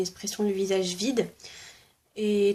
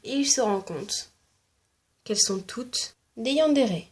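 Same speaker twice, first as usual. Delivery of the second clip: usual speaking voice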